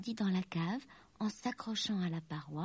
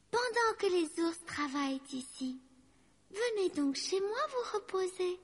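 high-pitched storybook lady; high, inviting character voice; dragon aunt hostess; 10 semitones higher than usual. A narrator's reading. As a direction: address the little workers in a high voice. Lucie invites elves to rest.

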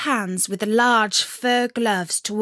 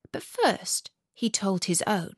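ordinary delivery

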